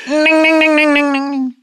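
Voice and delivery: high dinging bell that falls away; ding like a crossing bell passing by; train bell with Doppler effect. Pretend. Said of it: "of course, the train and the Doppler effect".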